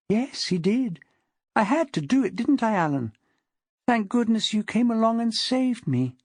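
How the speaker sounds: high, lilting, soothing woman's voice; sing-song consoling. A narrator's reading. Wife soothing her dangerous husband, consoling sing-song. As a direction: console him in an almost hypnotic sing-song. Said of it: "had taken on a sing-song sound, consoling and almost hypnotic".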